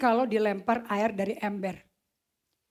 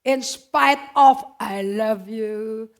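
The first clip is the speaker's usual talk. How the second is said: slow tender declaration; 7 dB louder, much slower than usual. Pretend. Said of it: "kalau gua sih".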